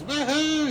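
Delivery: high joyful woo-hoo cry; happy whoop. Retold or imitated. Narrator voicing happy Shiva, high joyful whoop. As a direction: whoop like a carefree old man.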